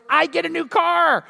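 high, drawn-out triumphant cry; mock gleeful exclamation. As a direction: imitate a man gloating over his prize. Husband gleefully awaits a blown tire.

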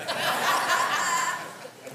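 sudden high laugh at himself. Real, not acted. Pastor laughing at his own singing, high laugh.

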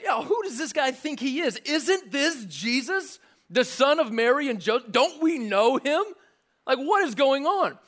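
incredulous hometown crowd scoffing; skeptical crowd. Acted. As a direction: sneer at him like a doubting neighbor. Crowd voiced mocking Jesus.

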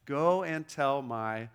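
normal talking voice

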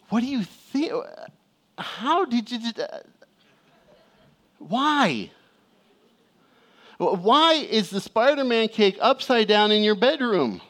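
baffled exasperation. Retold or imitated. Dad questioning kindergartner about the cake, baffled. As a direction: stretch it out in strained disbelief.

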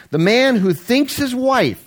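high, slow quote reading. Acted. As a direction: lift the voice to read the quote. Starts quote, breaks off.

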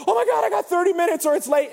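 high, loud frantic cry; hammed-up panic about beating the deadline; comic frantic exclamation; mock panic. Acted panicking video renter racing clock.

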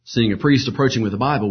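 usual speaking voice

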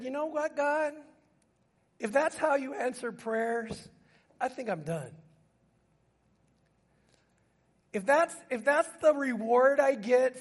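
acted-out grievance in prayer; retold challenge to God. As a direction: push the complaint up in pitch, hurt and challenging. Retelling sister's angry challenge to God.

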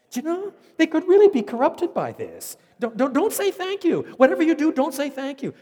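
mock alarmed shout. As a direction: squeal the warning in mock horror. Mocking the ungrateful church, shrill.

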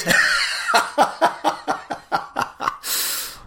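high unvoiced giggle; breathy laugh. Real, not acted. Laughing at his own mic test, long airy giggle.

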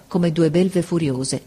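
ordinary delivery, nothing marked

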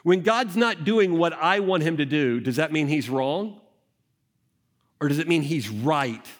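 ordinary talk, nothing marked

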